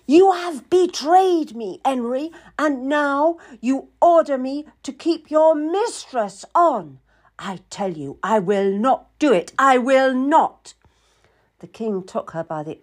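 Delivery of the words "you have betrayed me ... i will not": furious queen refusing her husband; mounting fury. A narrator's reading. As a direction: stretch the words in high-pitched, indignant anger. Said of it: "overtaken by a mounting fury".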